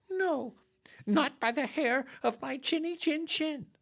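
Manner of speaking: very high, squeaky piglet voice; tiny pig voice up high; squeaky little pig. Acted. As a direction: squeak out a frightened refusal. Second pig refusing the wolf.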